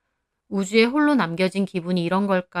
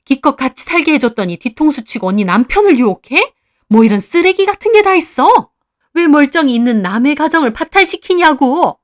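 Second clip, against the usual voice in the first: loud, high-pitched angry tirade; furious scolding; 6 semitones higher, 10 dB louder than usual. A narrator's reading. Mother-in-law scolds Heeju for wrecking the marriage, furious shouting. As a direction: lay into her at full volume. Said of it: "희주를 노려보며 호통을 치시더라고요".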